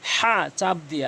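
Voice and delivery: হা pushed from mid throat with pressure; pressed ha. Shown as a demonstration. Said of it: "হা বলতে হবে চাপ দিয়ে". (চাপ দিয়ে হা)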